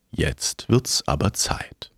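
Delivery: slow, low whisper, deathly calm. Acted. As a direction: breathe it out slowly like Death.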